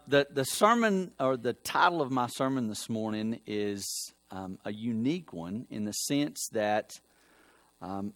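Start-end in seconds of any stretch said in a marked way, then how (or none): none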